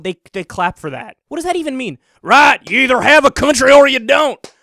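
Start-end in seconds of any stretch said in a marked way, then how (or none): 2.2-4.5 loud, dumb mock cheer; mocking the audience that claps for Trump, loud and dim; mock cheering crowd. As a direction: belt it like a clapping fan.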